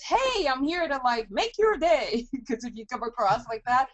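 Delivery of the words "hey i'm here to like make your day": higher, hammed-up sales cheer; overeager salesperson. Imitating a typical cold caller to a customer, mock cheery.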